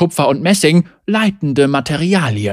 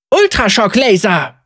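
battle cry; 6 semitones higher, 3 dB louder than usual. Acted. Zeri fires her weapon.

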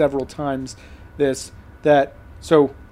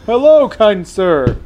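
loud, drawn-out, overly courteous hello; hammed-up gallant politeness; mock fawning greeting; 2 semitones higher, 7 dB louder, much slower than usual. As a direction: greet a stranger with exaggerated deference.

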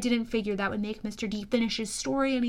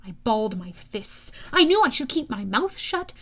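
ordinary delivery

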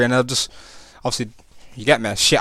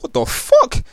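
shocked retort; 10 semitones higher, 4 dB louder, slower than usual. Retold or imitated. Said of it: "I'm like".

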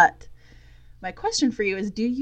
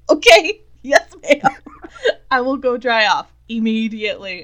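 louder, laughing through a mock yes ma'am; laughing obedience; 6 semitones higher, 13 dB louder than usual. Dad laughing as he obeys his daughter, mock meek. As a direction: giggle out the obedient reply.

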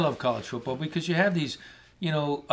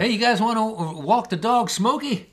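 higher, louder, a hint of a friendly coach; friendly locker room invite, higher and louder; mock team invite; 8 semitones higher, 4 dB louder than usual. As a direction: offer it like a cheery team insider.

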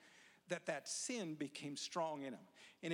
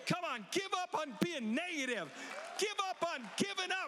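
very high, loud shouting exhortation; preacher's shouted climax; 12 semitones higher, 5 dB louder than usual. Acted. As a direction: cry it out at the top.

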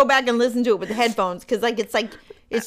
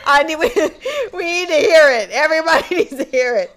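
laughing; 5 semitones higher, 4 dB louder, faster than usual. Real, not acted. Laughing fit while talking.

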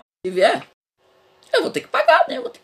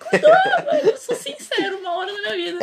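laughing while talking; 3 dB louder than usual. Real, not acted.

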